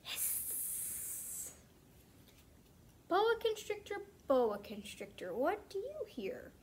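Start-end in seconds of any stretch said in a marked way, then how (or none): 0.0-1.5 breathy sss hiss of a boa; snake hiss. Pretend. Librarian making the boa constrictor noise for kids, hissing.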